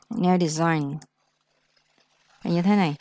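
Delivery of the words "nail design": Nail design said slowly with a soft falling close; teacher models nail design for the class; model pronunciation. Shown as a demonstration. Teacher slowly models the word for students. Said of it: "gọi là nail design".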